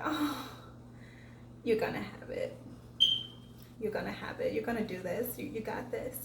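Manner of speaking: hushed whisper of resolve; soft inner voice telling herself she can do it; whispered self-talk. Retold or imitated. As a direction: whisper it to yourself, steadying. Quiet pep talk to herself.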